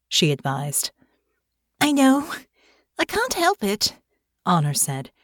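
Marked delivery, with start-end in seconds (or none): 1.8-3.9 put-on much higher, plaintive young voice. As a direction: whine it a little, like a child. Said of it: "winced at the plaintive tone in her own voice".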